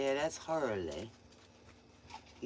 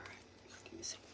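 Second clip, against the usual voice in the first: soft whisper; 21 dB quieter, faster than usual. Real, not acted. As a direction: whisper so nobody wakes. Quiet so as not to wake someone.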